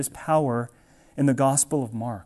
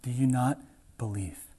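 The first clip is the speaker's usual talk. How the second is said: lower, much softer, tender; a tender question from a loving father; God asking gently; 5 semitones lower, 6 dB quieter than usual. Retold or imitated. God asks his doubting people, gentle and much softer. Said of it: "He's gonna ask".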